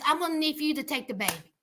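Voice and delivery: fast, breezy demand; offhand, hurried ask of her mother; entitled demand. Retold or imitated. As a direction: rattle off the request like it's nothing. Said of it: "she looks at her mom and says".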